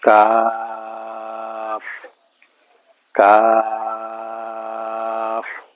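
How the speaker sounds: very long steady aa in "কাফ", closing on f; long drawn-out reading. Shown as a demonstration. Teacher showing a letter read long, stretched "কাফ". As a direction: draw "কাফ" out slowly before the final f. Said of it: "ওই হরফটা লম্বা করে পড়তে হয়".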